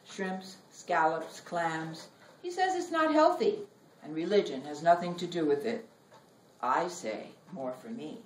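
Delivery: measured, expressive poem reading with long pauses; poetry reading. Acted. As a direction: read it like a poet on stage, savoring each image. Reads her poem aloud.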